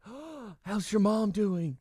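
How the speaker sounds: slow high imitated Southern drawl. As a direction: mimic mom's put-on accent, slowly. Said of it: "And she was like".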